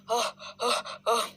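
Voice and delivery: short aching groans of aah; pained cry. Acted. Simba left alone cries out in pain. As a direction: cry out as if hurt.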